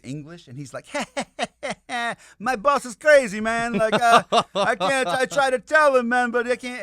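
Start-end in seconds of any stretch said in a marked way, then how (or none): 0.9-2.3 high, loud mock laugh; imitated laughter. Henchman laughing to the American, imitated high laugh. Retold English-speaker laughing at boss. 2.4-6.8 high, loud excited imitation; hammed-up exasperation. Lawyer confiding about his crazy boss, mock high-pitched exclaiming.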